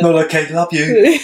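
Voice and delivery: lightly mimicking her friend, laughing; playful imitation with a laugh; impression of friend. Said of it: "Why are you doing an impression of me?".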